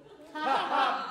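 big real belly laugh; a sudden roaring laugh from the back; loud burst of laughter. Real, not acted. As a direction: crack up loudly at the line.

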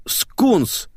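high cry of recognition; sudden exclamation. A narrator's reading. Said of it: "воскликнул я".

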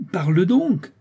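high, almost shouted order; exasperated near-shout. A narrator's reading. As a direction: burst out, beside yourself with impatience. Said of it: "cria presque la comtesse, hors d'elle-même". (presque crié, hors d'elle-même)

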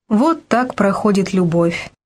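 usual speaking voice

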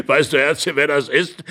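lightly voiced old man, tickled; laughing old man. A narrator's reading. Old man asking his wife, laughing. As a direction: ask through amusement. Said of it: "wandte sich lachend und hustend der Alte".

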